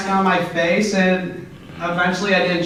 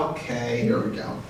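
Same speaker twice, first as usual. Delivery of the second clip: much softer, near-whispered giving in; resigned mutter; 8 semitones lower, 8 dB quieter than usual. Retold or imitated. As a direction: sink into defeat under your breath. Retold resignation to the car.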